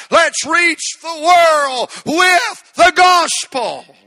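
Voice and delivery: loud, high preacher's cry, slowing as it peaks; preaching, building to full force. Real, not acted. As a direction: drive it up into a drawn-out shout. Preaches vision to congregation.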